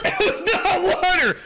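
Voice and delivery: high squeal through real laughter; laughing protest. Real, not acted. Drinker finds out it wasn't water, laughing protest. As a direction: protest while cracking up.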